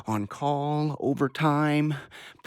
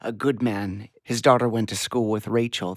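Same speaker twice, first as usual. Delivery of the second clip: ordinary delivery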